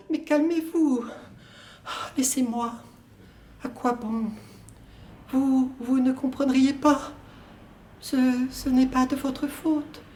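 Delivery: high, weary woman's lines, fading to a whisper; woman's voice, resigned. Acted. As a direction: push him away softly. Woman's part, bitter.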